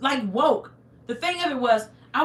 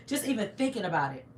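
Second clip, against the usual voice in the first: ordinary talk, nothing marked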